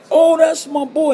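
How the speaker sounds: high, loud and slow, beaming with pride; proud exclamation. Retold or imitated. Old mentor proudly claims his student, beaming loud and high.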